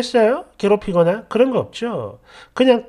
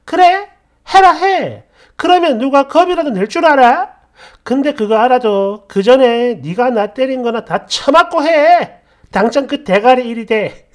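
threatening, raised-voice tirade; angry dare; 3 semitones higher, 6 dB louder than usual. Acted. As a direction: dare them to go ahead, furiously. Acted quarrel, daring and threatening.

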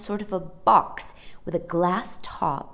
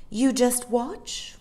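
smug challenge; 7 semitones higher, slower than usual. A narrator's reading. Mary Poppins smug challenge.

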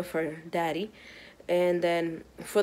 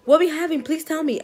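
higher and louder, begging; eager kid; 8 semitones higher, 5 dB louder than usual. Retold or imitated. Kids begging mom to reveal gender. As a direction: plead like an eager child. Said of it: "the kids are coming home like".

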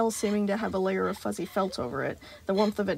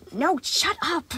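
higher, slow biting snap; sudden snap; 8 semitones higher, 4 dB louder, much slower than usual. Acted. Animatronic snapping at someone in his head, higher. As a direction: snap at an unseen voice. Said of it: "the animatronic snapped suddenly".

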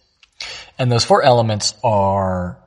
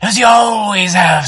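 louder and higher, projecting; 9 semitones higher, 7 dB louder than usual. Shown as a demonstration. Teacher demonstrating a loud character. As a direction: push it out loud like a talker. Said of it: "Is your character loud".

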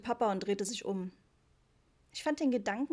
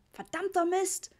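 roared curse; 9 semitones higher, 4 dB louder than usual. A narrator's reading. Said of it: "brüllte Papa".

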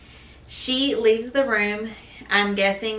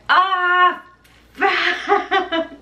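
much higher, loud pained curse; mock labor moan; 10 semitones higher, 6 dB louder than usual. Mother reenacting cursing loudly during a contraction. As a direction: cry out through a contraction. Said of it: "moaning loudly".

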